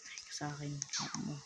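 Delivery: real whispered taunt. Real, not acted. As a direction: hiss it under your breath. Muttering threat at rival.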